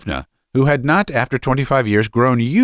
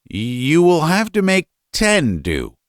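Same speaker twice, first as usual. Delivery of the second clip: higher-pitched, reluctant father; grudging father; 7 semitones higher than usual. Acted. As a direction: concede the money grudgingly, voice pitched up. Father grudgingly grants less money.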